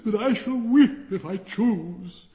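high, loud, slow tearful whine; proud rocket insisting on its right to cry; petulant wail. Acted. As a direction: sob it out like a spoiled child. Rocket declares he will weep.

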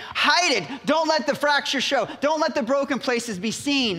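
high-pitched, acted urgency; urgent warning. Voicing those who would hide the cracks, urgent warning. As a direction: press it like an anxious order. Voices advice to conceal damage.

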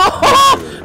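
high loud laugh of delight; burst of laughter. Real, not acted. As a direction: laugh out in surprised glee. Kills an enemy, laughs out.

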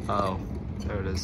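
ordinary talk, nothing marked

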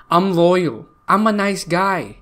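ordinary talk

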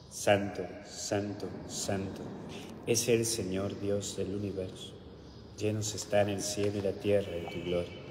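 normal talking voice

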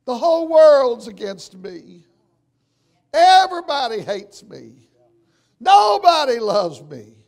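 loud mock wail of someone who feels persecuted; mock wail of a self-pitying complainer; self-pitying whine. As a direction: ham up the poor-me complaint.

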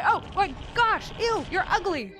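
high-pitched disgusted outburst; grossed-out, high yelp; yelling at a creature. Real, not acted. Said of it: "I was yelling at the creature".

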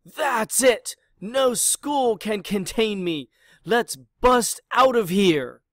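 rally the class to break out; bellowed. Acted. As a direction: Pig bellowing at his classmates to escape. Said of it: "He bellowed".

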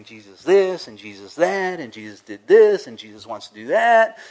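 exasperated relatives imitating his Jesus talk; mocking complaint.

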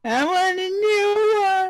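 put-on high whining child voice; whiny. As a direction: whine like a sulky kid. Whining over broken pickaxe.

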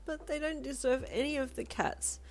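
high, whiny protest, a touch of sobbing; tearful blurt. A narrator's reading. Child protests to Lolita about the cats, tearfully. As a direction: blurt it out high, drawing out the end. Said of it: "blurted out, still sobbing a bit".

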